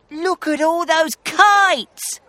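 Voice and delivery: high, loud excited exclamation; Danny crying out. A narrator's reading. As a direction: call it out in amazement, pitched right up. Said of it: "cried Danny".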